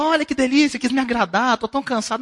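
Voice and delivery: high, bright thanks for the dinner; pleased spouse. Acted. Husband thanks wife for dinner, high and pleased. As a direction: play the grateful spouse, pitched well up.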